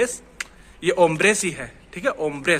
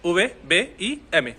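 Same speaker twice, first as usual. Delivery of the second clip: very slow, word by word; slow title read; much slower than usual. Shown as a demonstration. Teacher reads the lesson title out slowly. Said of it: "वो title है वो ये है".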